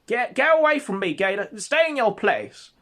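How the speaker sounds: high, loud scornful yell; hammed-up contemptuous holler; derisive drunken Ralph. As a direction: shove them away with a drunken, derisive shout. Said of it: "something of complete derision to Gator".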